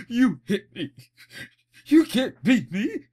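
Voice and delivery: high, loud bratty wail; whiny crybaby Dio. Retold or imitated. Beaten Dio whines at Jonathan, high loud crybaby wail. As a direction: wail like a sore loser. Dio crying after losing.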